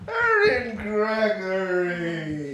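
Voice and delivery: loud, drawn-out call of a name. Acted. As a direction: call her name from afar, slowly. Calling someone across the room.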